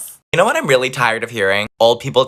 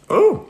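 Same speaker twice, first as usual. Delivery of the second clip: high ooh. Real, not acted. Tasting pie.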